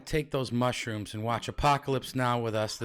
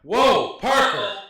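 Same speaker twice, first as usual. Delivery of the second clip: loud mock outrage; teasing shout; 7 semitones higher, 9 dB louder, much slower than usual. Real, not acted. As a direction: call him out loudly.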